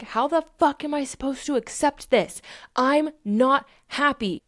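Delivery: worked-up, high retold protest; acted exasperated protest. Acted distressed protest while being upset.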